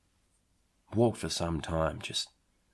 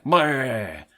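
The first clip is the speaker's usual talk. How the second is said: silly blurt, higher and louder; mock bleh face noise; 6 semitones higher, 6 dB louder than usual. Narrator mimicking the shark's face with a louder blurt. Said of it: "making fingers like".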